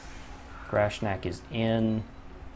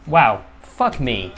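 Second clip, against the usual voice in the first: drawn-out exasperation; 6 dB louder, much slower than usual. Real, not acted. Dice fail, exasperated groan.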